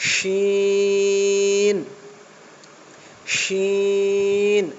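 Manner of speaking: শিন with a long hushing sh from the middle of the tongue; thick sh sound. Shown as a demonstration. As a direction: push the air out through the middle of the tongue on শিন. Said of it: "গাঢ় হবে".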